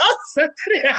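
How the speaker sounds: laughing. Retold or imitated. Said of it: "I was like".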